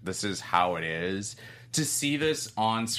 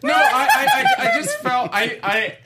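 in a tight corset; 11 dB louder than usual. Real, not acted. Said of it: "My corset's tight".

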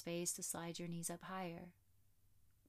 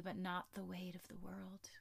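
soft spa whisper; 3 dB quieter than usual. Acted. Shavasana guidance whispered.

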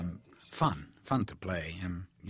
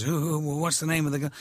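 higher, louder flustered asking; flustered question; 7 semitones higher, 4 dB louder, faster than usual. Retold or imitated. Stanley asks his secretary about the girl, flustered. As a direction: blurt it out, a touch panicked.

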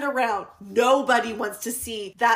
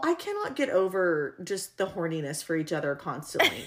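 normal talking voice

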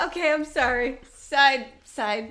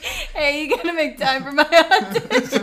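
laughing; 4 semitones higher, 8 dB louder than usual. Retold or imitated. Mock demand to employer, laughing.

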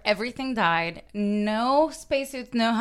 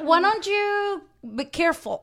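slow, high, sarcastic warning; mock scolding; 7 semitones higher than usual. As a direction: drawl it out like a nagging parent.

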